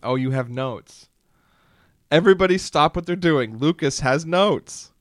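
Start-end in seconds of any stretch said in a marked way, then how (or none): 2.1-4.9 mock announcement.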